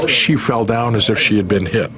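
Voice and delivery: usual speaking voice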